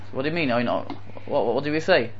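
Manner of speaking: soft, puzzled stammer; acting a meek, baffled passenger; low, quiet confusion; taken aback. Acted passenger, puzzled by the scolding.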